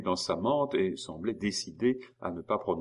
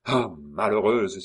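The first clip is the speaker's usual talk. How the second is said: slow, loud cry of dismay; 2 semitones higher, 6 dB louder, slower than usual. Acted. Maurice alarmed at the Tison woman's news, a slow loud cry. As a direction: cry out in alarm at her blunder. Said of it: "s'écria Maurice".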